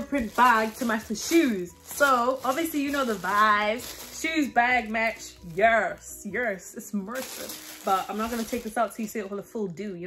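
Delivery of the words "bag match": usual speaking voice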